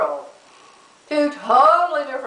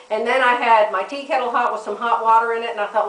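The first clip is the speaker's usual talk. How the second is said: usual speaking voice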